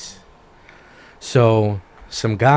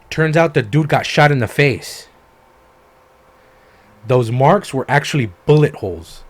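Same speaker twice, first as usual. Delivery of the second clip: emphatic shocked reveal; 4 semitones higher, 6 dB louder than usual. Real, not acted.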